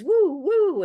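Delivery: high, loud train-whistle woo woo. Acted. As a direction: call it out like a toy train whistle. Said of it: "a lot of it is".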